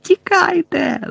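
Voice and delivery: high, drawn-out yell; yelled warning. A narrator's reading. Harry yelling to his friends to flee, high drawn-out shout. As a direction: cry out long and high to flee. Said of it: "загорлав Гаррі".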